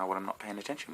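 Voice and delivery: ordinary delivery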